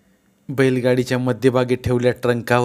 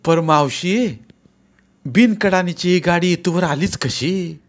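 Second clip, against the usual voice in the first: surprised; 7 semitones higher, 3 dB louder, slower than usual. A narrator's reading. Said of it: "आश्चर्य व्यक्त करीत".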